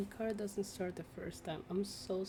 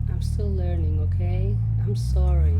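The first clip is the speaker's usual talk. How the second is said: loud defensive apology; 20 dB louder than usual. Real, not acted. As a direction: shout out the excuse.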